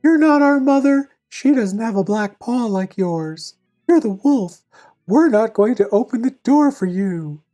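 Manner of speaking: put-on high-pitched kid goats refusing; defiant little kids. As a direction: pipe up as the young goats, standing firm. Kids refuse wolf again.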